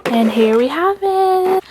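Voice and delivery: excited reveal. Real, not acted. Reveal of light stick.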